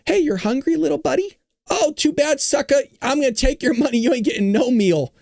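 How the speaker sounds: put-on high taunting villain voice, teasing a hungry child; mock sadistic fraudster. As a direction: sneer at the kid in a high mocking sing-song. Voicing sadistic fraudsters to kids.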